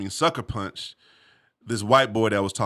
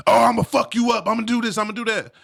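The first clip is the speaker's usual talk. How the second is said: higher, louder, fast hammed-up threats; mock furious threat; 11 semitones higher, 5 dB louder than usual. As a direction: play the guy wilding out in the headlock. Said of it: "wilding out".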